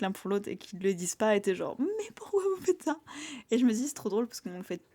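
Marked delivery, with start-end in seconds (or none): 1.8-3.0 high, slow, exasperated plea; exasperated reader. Retold or imitated. A frustrated reader asking the characters why, exasperated. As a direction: beg the characters to stop being silly.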